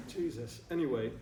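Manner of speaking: ordinary talk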